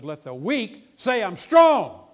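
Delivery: slow, high, full-force shout; drawn-out preacher's shout; emphatic proclamation. Acted. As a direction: proclaim the verse to the rafters.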